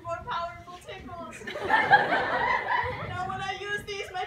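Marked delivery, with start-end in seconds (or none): 1.5-3.1 high giggling cackle. Acted. Tickler character cackle.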